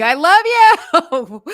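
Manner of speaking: big drawn-out scream of love. Retold or imitated. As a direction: yell it slow across the room. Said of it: "I started screaming".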